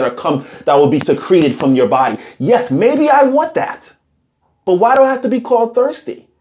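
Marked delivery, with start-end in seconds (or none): none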